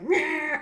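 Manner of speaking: high, loud wordless snarl; a short, hammed-up "meh!" at the child; mock angry noise. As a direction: imitate a frazzled parent's growl. Parent snapping at child, acted.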